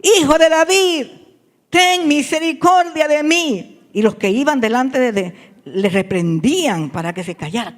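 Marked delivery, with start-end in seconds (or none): 0.0-3.8 high, loud drawn-out plea to Jesus; acted blind man's loud appeal; crying out for help. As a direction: call out over a passing crowd, imploring. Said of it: "dio voces diciendo".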